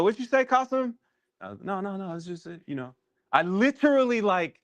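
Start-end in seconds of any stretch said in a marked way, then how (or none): 0.0-0.9 lightly mocked high-pitched query; imitating puzzled listeners. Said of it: "they'd be like". 1.6-2.9 very soft, backing-off reenactment; voice taken down. Retold or imitated. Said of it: "took my voice down".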